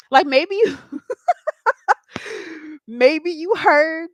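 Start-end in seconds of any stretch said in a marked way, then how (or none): 0.8-2.8 giggle. Real, not acted. Laughing mid-sentence.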